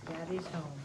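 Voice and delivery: ordinary delivery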